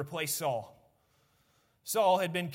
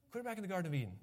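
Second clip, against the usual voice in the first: "Clear back in the Garden of Eden" repeated much softer; soft echo; 7 dB quieter, much faster than usual. Shown as a demonstration.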